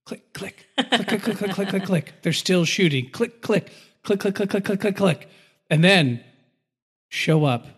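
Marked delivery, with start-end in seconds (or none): 0.1-5.3 empty gun clicks. Pretend. Mimics empty guns clicking.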